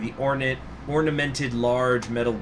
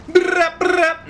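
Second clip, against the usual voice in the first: gunfire noise; 8 dB louder than usual. Pretend.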